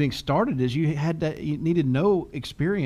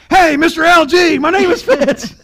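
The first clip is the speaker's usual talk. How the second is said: much higher and much louder, shouted phone greeting; yelling into the phone; 12 semitones higher, 12 dB louder than usual. Retold or imitated. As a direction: blast the greeting at the caller. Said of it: "yelling at people on the phone".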